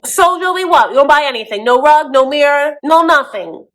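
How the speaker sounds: high, loud, indignant rant; hammed-up outrage at a boyfriend; mock pissed-off girlfriend. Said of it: "Yara's so pissed at this".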